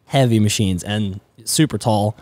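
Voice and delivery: usual speaking voice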